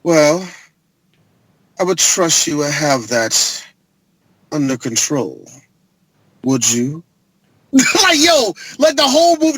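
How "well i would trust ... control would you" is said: low, slow, menacing boss voice; a cold villain boss purring a threat; a hint of a smug mob boss; Dean Norris impression. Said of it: "this is exactly how Dean Norris acted".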